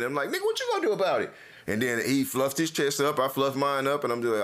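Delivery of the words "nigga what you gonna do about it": high, rapid confrontational taunt; fast challenge. Retold or imitated. Daring the man who defended the woman, fast angry taunt.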